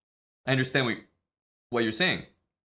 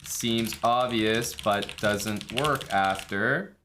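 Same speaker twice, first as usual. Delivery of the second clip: slow dictated note; much slower than usual. Shown as a demonstration. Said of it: "I would put that in my notes".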